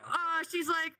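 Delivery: ordinary talk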